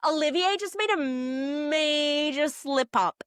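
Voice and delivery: lightly hammed-up tattling, stretching the words; breathless-news gossip retold. Ilari sprints out to tell everyone, mock dramatic. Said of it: "sprints out the room and is like".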